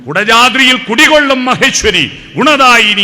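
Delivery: loud, high calling out to the goddess; loud raucous invocation; shouted invocation. Shown as a demonstration. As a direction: bellow the prayer. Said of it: "അട്ടഹാസത്തിലൂടെ ദൈവം".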